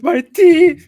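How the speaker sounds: very high-pitched, loud mock wailing; mock victim wail. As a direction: squeal it like a hammed-up crybaby. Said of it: "they was like".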